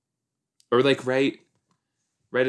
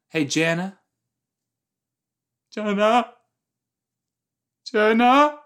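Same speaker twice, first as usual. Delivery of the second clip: calling out; 10 semitones higher, 4 dB louder than usual. Real, not acted. Calling someone across the house.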